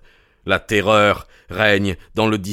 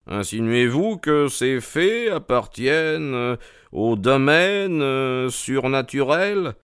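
higher, drawn-out probing question; incredulous questioning; 5 semitones higher than usual. Acted. Holmes questions Mortimer about the supernatural, incredulous. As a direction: spell out the doubt slowly at the end.

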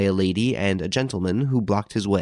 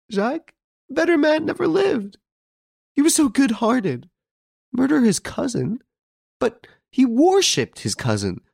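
put-on much higher, tearful old mother pleading; crying amid sobs; 11 semitones higher than usual. A narrator's reading. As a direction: plead for his life through tears. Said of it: "she at once cried, amid her sobs".

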